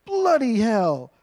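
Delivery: slow, mock British swearing; imitating his son's British accent, drawn out. Son swearing at his video game, in a put-on British accent. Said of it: "if you do it in a British accent".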